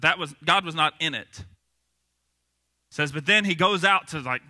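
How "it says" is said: ordinary delivery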